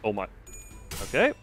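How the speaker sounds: ordinary talk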